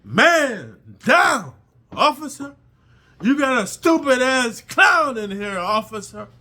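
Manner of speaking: mock alarm yell to the guards. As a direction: shout down the tier for help. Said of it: "talking about".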